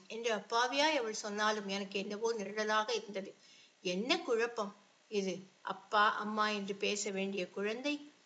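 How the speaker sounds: ordinary delivery, nothing marked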